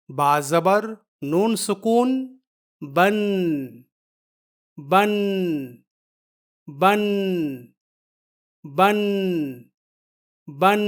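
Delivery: teacher drilling the sound بن; spelling-out demo. Shown as a demonstration. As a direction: spell it out, then repeat بن with a held n. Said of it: "دونوں کی آواز میں".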